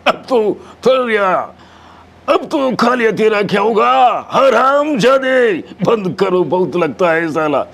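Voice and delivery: high, shouted film-villain menace; Amjad Khan impression. Mimic as villain threatens his failed henchman, drawn-out high shout. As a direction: bellow it in long, threatening phrases. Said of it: "Amjad ఖాన్ది".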